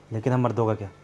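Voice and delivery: husband, hurt, quietly tells her he's decided; bitter hurt. Acted. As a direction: speak with cold hurt.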